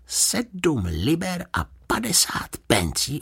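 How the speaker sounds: slow, high, hushed disbelief; disbelieving. A narrator's reading. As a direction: repeat the price as if you can't believe your ears. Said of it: "nemohl uvěřit svým uším". (nevěřícně, pomalu)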